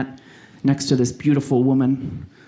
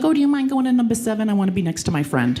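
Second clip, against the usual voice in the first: high-pitched imitation of another woman; put-on high voice for a polite request; woman in class; 7 semitones higher than usual. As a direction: ask it high, as the other student. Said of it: "And she's like".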